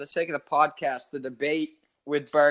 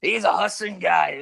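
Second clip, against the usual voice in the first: put-on drunk dad; 2 semitones higher, 5 dB louder than usual. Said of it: "my dad said some, like, idiot shit".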